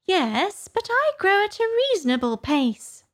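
very high-pitched Dormouse; high, huffy Dormouse; Dormouse retorting. A narrator's reading. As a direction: argue back up high as a little creature.

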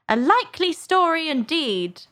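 high, scornful disbelief; deep contempt. A narrator's reading. Pigeon dismissing Alice's answer, contemptuous. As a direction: scoff in a high bird voice. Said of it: "a tone of the deepest contempt".

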